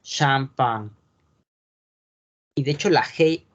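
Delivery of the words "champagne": Champagne as shampañ, slow and careful; French pronunciation. Shown as a demonstration. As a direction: model the French Champagne for the class. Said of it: "no se pronuncia". (pronunciación francesa de Champagne)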